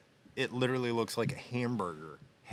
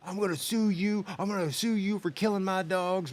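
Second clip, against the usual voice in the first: much higher put-on voice of a furious neighbor; angry dog owner; 10 semitones higher than usual. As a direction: mimic the dog owner threatening to sue. Angry dog owner threatening him.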